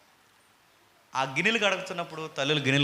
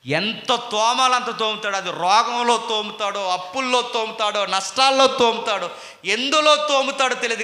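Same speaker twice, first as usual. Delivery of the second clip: loud, higher preacher's cry; forceful preaching; 8 semitones higher, 10 dB louder than usual. Acted. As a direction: drive the story home from the pulpit.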